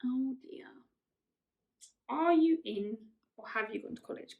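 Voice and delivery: ordinary delivery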